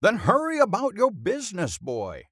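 high-pitched brisk command; pompous headmaster. A narrator's reading. Headmaster sends pupil to help performers, pompous command. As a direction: send him off grandly.

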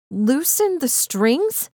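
slow, high, disbelieving echo; seething. Acted. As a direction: repeat it back in rising anger. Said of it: "suddenly seething".

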